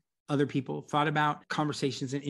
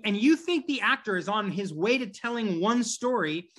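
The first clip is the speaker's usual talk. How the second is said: ordinary delivery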